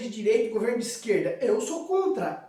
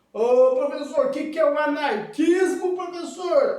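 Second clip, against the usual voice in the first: loud, higher-pitched pupil question; pestering student; 4 semitones higher, 5 dB louder than usual. Acted. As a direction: call out like a puzzled student. Voices a student asking.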